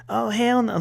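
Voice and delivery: high, sassy housewife outburst; sassy reality show voice. Retold or imitated. Quoting a reality show fight.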